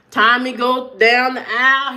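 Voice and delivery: ordinary talk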